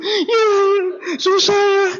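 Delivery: high, loud mock weeping along; joining the crying. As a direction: sob along with her, half laughing. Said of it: "mau ikut nangis juga". (upline ikut menangis bersama downline)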